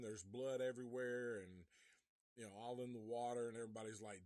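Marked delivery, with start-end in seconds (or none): none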